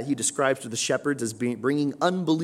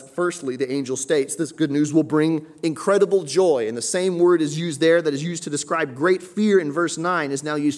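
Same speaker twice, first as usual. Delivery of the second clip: loud, raised preaching voice; fervent preaching; 5 semitones higher, 7 dB louder than usual. Acted. Pastor preaches joy to the congregation, loud and fervent. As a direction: build up the congregation's excitement.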